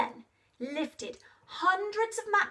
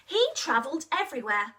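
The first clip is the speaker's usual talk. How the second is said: ordinary delivery, nothing marked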